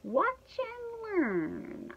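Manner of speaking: very slow, drawn-out, high-pitched showing off; cocky boast. A narrator's reading. Dog boasts to the others.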